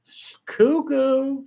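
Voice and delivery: high, drawn-out taunt; mocking. Real, not acted. Host calling the streamer crazy, mocking. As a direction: sing out the insult, slowly.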